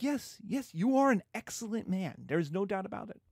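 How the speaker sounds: high, eager hammed-up Capulet; father pitching a party to the suitor; mock Capulet, eager. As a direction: gush like an eager matchmaking dad. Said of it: "And Capulet's like".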